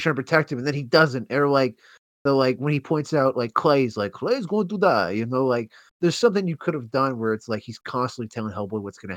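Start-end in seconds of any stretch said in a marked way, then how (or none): 4.1-5.1 villain prediction. Retold or imitated.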